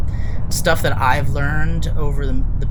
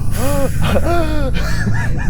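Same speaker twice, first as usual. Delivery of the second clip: sharp high gasp of breath; deep gasping inhale; 10 semitones higher than usual. Shown as a demonstration. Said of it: "take a deep breath".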